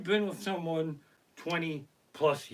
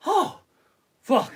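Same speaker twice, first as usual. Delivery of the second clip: high startled oh; startled waking gasp; 12 semitones higher, 8 dB louder than usual. Retold or imitated. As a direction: jolt awake in relief. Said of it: "you were going".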